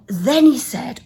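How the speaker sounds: ordinary delivery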